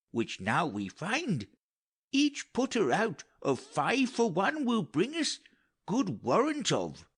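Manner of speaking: a lightly voiced old counsellor, rising high on the wonders; old courtier's tale. Old counsellor reassures the king, lifting high in wonder. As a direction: tell the marvels with climbing wonder. Gonzalo recalls travellers' tales.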